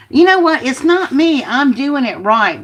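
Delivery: a sudden high, loud burst of frustration; exasperated outburst. Real, not acted. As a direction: snap it out, fed up with the uneven paper. Frustrated crafter scolding the paper.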